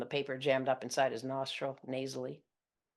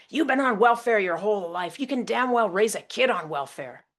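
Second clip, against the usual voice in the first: loud, raised angry rant; angry dad; 8 semitones higher, 10 dB louder than usual. Retold or imitated. Father berating his daughter over welfare, loud and angry. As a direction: berate her with contempt.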